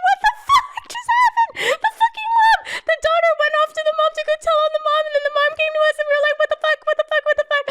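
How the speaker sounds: very high squeal through laughter; hysterical, giggly retold shock; incredulous outburst. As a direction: race through it in squealing disbelief. Reliving shock at being kicked out.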